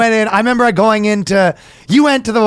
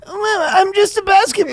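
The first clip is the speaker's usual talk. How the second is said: high, nasal-free mock nebbish ball. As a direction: lightly put on a nervous nebbish.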